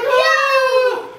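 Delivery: high wailing no; hammed-up high no from the crying lady; mock wail. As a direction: cry out no, high and teary. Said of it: "She was like".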